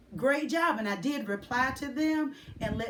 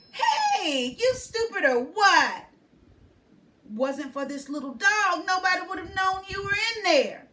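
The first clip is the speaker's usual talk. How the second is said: put-on higher, louder cheeky girl; sassy girl; 7 semitones higher, 6 dB louder than usual. A narrator's reading. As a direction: tease him like a bold little girl. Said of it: "The girl with the wild hair says".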